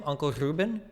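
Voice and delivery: soft, high questioning Uncle Ruben, R trilled; rolled R. Shown as a demonstration. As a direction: softly try a rolled R on Ruben. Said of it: "do you roll his name?".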